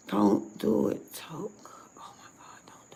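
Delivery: breathy hushed whisper, holding himself back. Real, not acted. Telling himself not to lose his temper: hushed whisper. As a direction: whisper a warning to yourself.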